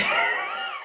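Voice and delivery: squealing laugh; high laugh. Real, not acted. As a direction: laugh at yourself. Laughs at falling.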